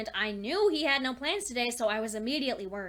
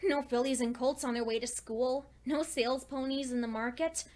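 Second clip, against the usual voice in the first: normal talking voice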